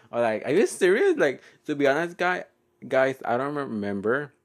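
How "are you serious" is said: high, loud incredulous question; mock disbelief. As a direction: blurt it out in put-on shock. Retelling her shocked reaction to friend.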